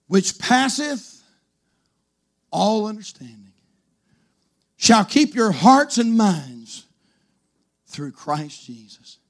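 slow, measured scripture recitation; slow scripture reading. Acted. Preacher reading Philippians to the church, slow. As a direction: weigh each phrase slowly.

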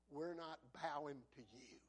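low, hushed refusal; quiet defiance. Acted. Three men refuse the king, quiet low defiance. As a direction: drop right down and state it softly.

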